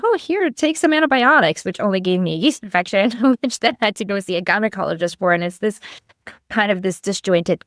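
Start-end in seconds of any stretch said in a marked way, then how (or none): 0.0-1.6 high, breezy doctor voice; lightly mocking doctor. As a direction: toss it off like a careless doctor. Doctor voiced in retold story.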